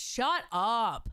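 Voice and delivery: usual speaking voice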